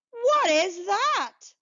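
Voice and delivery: high, slow, amazed question; a high, slow, astonished ask; surprised question. Acted. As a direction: gasp it out in wonder.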